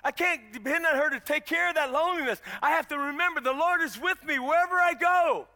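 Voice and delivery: loud, full-force preaching. Real, not acted. Preacher telling church the Lord is with them, preaching at full force.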